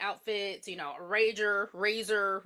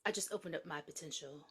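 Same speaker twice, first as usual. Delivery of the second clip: smug calm; 4 semitones lower, 10 dB quieter than usual. Retold or imitated. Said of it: "And he's like".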